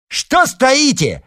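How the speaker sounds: high, slow, loud demand; sharp shout. A narrator's reading. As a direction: bark the question at them. Fat Prim snaps at frozen hunters.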